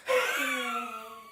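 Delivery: long, thin wheezing laugh, falling away; laugh like deflating balloon. Acted. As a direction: let the laugh leak out like air. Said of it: "a deflating".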